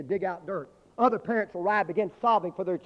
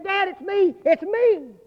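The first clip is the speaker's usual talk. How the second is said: put-on much higher and loud child's voice; child calling out; 13 semitones higher, 7 dB louder, slower than usual. Buried boy calls up to his father, high loud child cry. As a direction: call up from under the rubble as a small boy.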